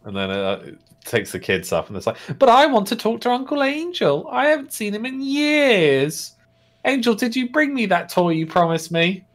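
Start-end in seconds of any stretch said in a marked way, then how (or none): 2.4-9.2 put-on high, whiny little-kid voice; pleading child. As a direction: plead like a small child, drawing out the ends.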